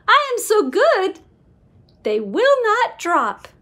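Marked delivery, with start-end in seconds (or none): none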